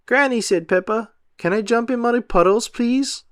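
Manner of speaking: put-on high begging girl voice; pleading little girl. A narrator's reading. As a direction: beg sweetly for a treat. Peppa begs.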